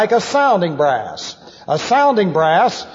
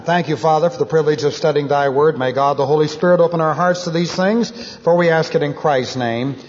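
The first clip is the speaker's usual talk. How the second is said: closing prayer; 5 semitones lower than usual. Acted.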